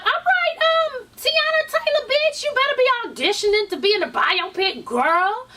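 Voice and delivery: playful mock shout-out; hyped cheering. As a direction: gas up a skinny friend. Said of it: "And I was like".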